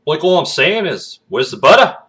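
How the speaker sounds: loud, high Aussie with lifted endings; Australian accent. Shown as a demonstration. Demonstrating the inflection tip, loud high Australian accent. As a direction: hit a broad Aussie, pitch up at the end. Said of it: "change it into Australian".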